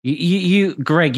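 ordinary talk

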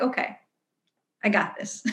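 soft, settled self-reassurance; calmed down. Acted. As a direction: say it quietly to yourself, newly calm. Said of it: "all of a sudden you've calmed down".

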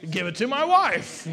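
high, loud joking shout; mock call-out. Preacher joking about his used tissue, mock shout. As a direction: call it out for a laugh, swooping up.

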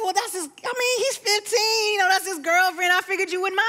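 high-pitched flustered excuse-making; high, stammered self-justifying imitation; defensive brother. Brother defending himself, voiced by sister.

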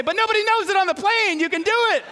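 high, sly tempter whispering permission; a little devil on the shoulder; mock-tempting inner voice. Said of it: "I had another voice say".

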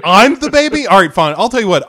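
high, loud incredulous retort; hammed-up offended outburst, coming down gradually; mock indignation. Griffin retorts to mom calling him baby, indignant.

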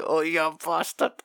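under the breath, half laughing at the pain; mock actor's thought. Actor taking the slaps, mock pained mutter. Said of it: "And you're thinking".

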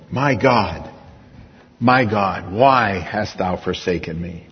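low, soft, hushed lament; preacher softly voicing Jesus' forsaken cry from the cross; anguished cry. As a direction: let the words sink, heavy and hushed.

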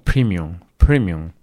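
premium said as primium, twice; English premium. Shown as a demonstration. Showing the audience the English form, primium. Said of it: "pero sería como".

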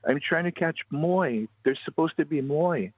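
high, drawn-out plaintive complaint; pitiful child's voice. Acted. Little girl explains to the tour owner, acted pitiful whine. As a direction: play a tearful little girl, stretching words slowly. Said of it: "like, pitifully crying".

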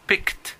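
"Picked" said alone, ending crisply in a t sound; model word picked. Shown as a demonstration. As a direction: repeat "Picked" as a model, final t clear. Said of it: "La primera palabra es picked".

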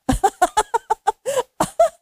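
high, rapid peal of laughter; put-on group-starting laugh; demonstrated laughter. Shown as a demonstration. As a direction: let out a long, high staccato laugh. Leads women in acted laughing.

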